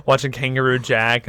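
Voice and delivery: ordinary talk, nothing marked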